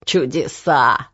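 low, drawn-out wonder; marvelling coachman. A narrator's reading. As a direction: stretch it out, amazed.

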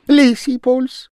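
very high, loud, rushed patter; fast-talking Japanese man. A narrator's reading. The Japanese man pattering at the gentleman, high and loud. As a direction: talk him round in a rush.

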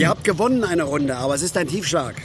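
loud bitter outcry; angry shout. Acted. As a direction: throw it at them like an accusation. Bitter outburst continues.

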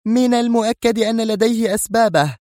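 edgy, very high-pitched reply; irritable. A narrator's reading. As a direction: answer with nervous irritation. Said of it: "وهي تجيب في عصبية". (بعصبية)

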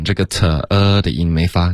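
ordinary talk, nothing marked

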